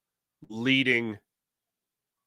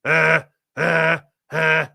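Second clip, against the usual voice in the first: louder, different voice, stammering grunts; imitating Forrest Gump; 4 semitones higher, 7 dB louder, much slower than usual. Podcaster imitates Forrest Gump's stammer for his chat, louder and in a different voice. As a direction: mimic the boy's blank stammer. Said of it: "Forrest Gump looks at him and goes".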